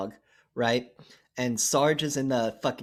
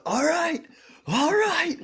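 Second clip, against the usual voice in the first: psyching up; 5 dB louder, slower than usual. Retold or imitated. Spoonie psyching himself up to fight.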